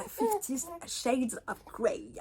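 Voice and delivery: a light mock English accent; lightly hammed-up fake English accent; bad English accent. Vlogger mocking her own English accent on the title. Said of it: "mon magnifique accent".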